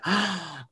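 sharp high intake of breath; starstruck gasp. Acted. Fan seeing a Broadway actor, starstruck gasp. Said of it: "any actor you're like".

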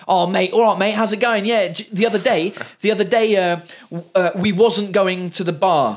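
high, loud cheery chav voice with bad grammar; uneducated English bloke. Mocking how his French mistakes sound to locals, as a loud high bloke. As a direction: play a dim-witted lad down the pub. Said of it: "somebody that sounds like me in English being like".

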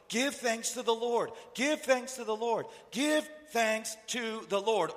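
high, loud and slow repeated command; insistent command. Acted. God's command, repeated over and over.

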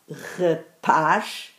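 rapaz split up, with paz held long; stress on PAZ. Shown as a demonstration. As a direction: lean on the last syllable of rapaz. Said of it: "paz é a sílaba tónica".

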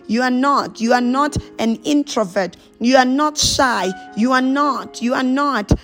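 usual speaking voice

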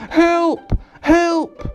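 very high, loud cry for help; top-of-the-voice shout. A narrator's reading. Mister Noisy calling for rescue, top-of-the-voice shout. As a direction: yell it as loud as you can. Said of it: "shouted at the top of his very, very loud voice".

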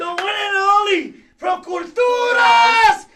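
loud, slow ring-announcer shout. Acted. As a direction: stretch it out like a wrestling MC. Host announces guest.

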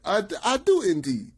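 imitated Southern rapper accent, pitched high. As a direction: do a hammed-up accent, high and formal. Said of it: "it's like he talk like that".

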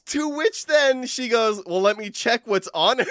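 high, helpless giggly voice; laughing while telling. Real, not acted. Game master cracking up over rogue looting, laughing. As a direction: squeeze the words out through laughter.

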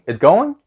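"It's going" with "going" climbing high at the end; rising question tune. Shown as a demonstration. Teacher translates ça va literally, "going" rising as a question. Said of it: "you have to go up at the end".

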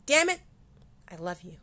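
loud mock-frustrated curse; exasperated outburst. Real, not acted. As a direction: blurt it in comic frustration. Exasperated outburst about hair.

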